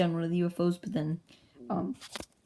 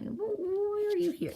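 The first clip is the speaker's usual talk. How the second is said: very high squeaky mock question; startled questioning; slower than usual. Base crew questions the unexpected arrival, startled high voice. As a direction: squeal the question, much higher.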